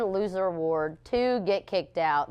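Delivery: ordinary delivery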